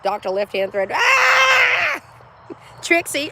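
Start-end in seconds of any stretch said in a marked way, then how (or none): 0.9-2.0 loud, high playful scream; excited yell. Real, not acted. Streamer hyping her chat, gleeful yell. Playful yelling during the hype.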